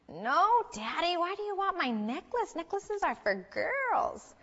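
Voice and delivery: put-on high, drawn-out child's protest; high little-girl voice, stretching the last word. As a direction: whine it up high like a small daughter refusing Daddy. Daughter answers dad in story.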